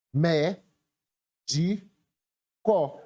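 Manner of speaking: "mé, de, có" with long pauses between; three separated syllables, evenly weighted. Shown as a demonstration. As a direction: dictate each syllable on its own. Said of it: "Separando a palavra em sílabas".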